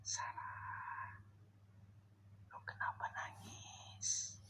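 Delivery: hushed slow whisper calling Sarah; fearful whisper. Real, not acted. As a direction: barely breathe the question. Whispering to unseen crying ghost. (berbisik pelan ketakutan)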